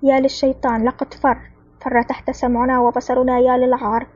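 plain reading voice

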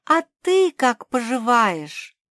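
high, loud, slow question; calling out. A narrator's reading. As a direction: call a slow friendly enquiry. Calling to someone behind the stone. (громкий протяжный вопрос)

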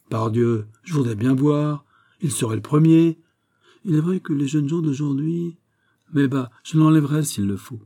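lightly voiced Porthos, sure of his method; Porthos, blustering and confident. (Porthos fanfaron, sûr de lui)

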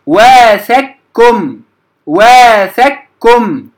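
واثقكم said slowly as waathakkum; thin doubled k from the start in واثقكم; light merged kaf. Shown as a demonstration. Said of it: "مرققة من أول النطق بها".

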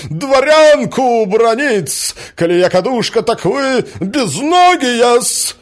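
high, loud, drawn-out indignation; outraged scolding. A narrator's reading. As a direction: berate him as a wronged lady. Woman rages at the prince. (возмущённо отчитывать, криком)

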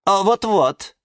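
high, snide chiming in; high-pitched gloating assent; spiteful agreement. A narrator's reading. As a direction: egg them on maliciously. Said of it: "ехидно поддакнула орхидея Галатея".

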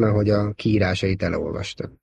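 usual speaking voice

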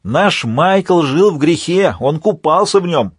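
high, drawn-out acted cry of accusation; narrator's high-pitched villain shouting; shouted revelations. A narrator's reading. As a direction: cry out the sins for all to hear. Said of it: "Альфа выкрикивал свои откровения".

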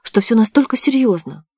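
startled and doubting; shocked disbelief. A narrator's reading. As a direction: ask it in mild alarm, voice lifted. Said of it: "ахнула девушка".

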